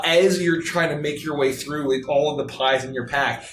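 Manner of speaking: usual speaking voice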